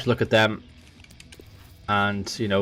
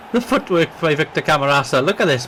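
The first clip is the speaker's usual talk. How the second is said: laughing; 6 semitones higher, 4 dB louder than usual. Real, not acted. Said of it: "That got me really excited".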